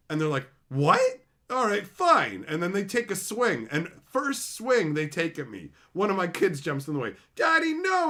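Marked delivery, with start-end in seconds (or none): 0.7-2.4 high, loud startled "What?", then grudging; startled opponent. Retold or imitated. Challenged fighter, startled, then grudgingly agreeing. 7.3-8.1 put-on high, loud kid's cry; child crying out. Kid leaping in front of the sword, crying to his dad.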